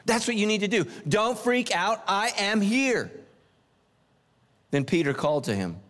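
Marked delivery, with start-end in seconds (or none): 1.1-3.1 slow, gentle, calm assurance; a touch of divine calm; slow reassurance. Retold or imitated. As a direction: comfort them, stretch it out. Voicing God's reassurance.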